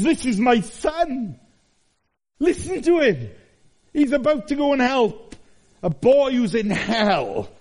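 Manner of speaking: high, slow booming proclamation of God; proclaiming Father. Acted. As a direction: announce it from the mountain. The Father speaks at Transfiguration.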